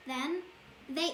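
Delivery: usual speaking voice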